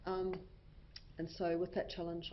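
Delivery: ordinary talk, nothing marked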